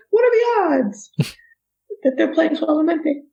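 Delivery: high-pitched retold astonishment; amazed disbelief. Dancer hears her salsa song in a bar, amazed. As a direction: react as if the coincidence is incredible.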